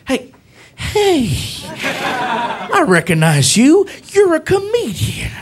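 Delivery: high, drawn-out dawning recognition; police officer. Retold or imitated. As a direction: imitate a slow, star-struck cop. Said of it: "He's like".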